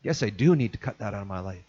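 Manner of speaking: low, soft self-talk; quiet inner resolve. Retold or imitated. Repentant listener thinking it over, low and soft. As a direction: murmur it as a dawning conviction. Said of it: "then that voice starts to go".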